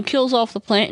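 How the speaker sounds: ordinary talk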